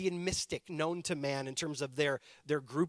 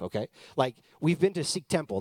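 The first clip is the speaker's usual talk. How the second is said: ordinary talk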